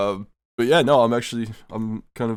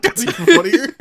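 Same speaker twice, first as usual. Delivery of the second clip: laughing; 6 dB louder than usual. Real, not acted. Laughing while quoting self.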